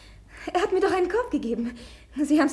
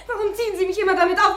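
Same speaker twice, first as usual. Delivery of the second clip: ordinary talk, nothing marked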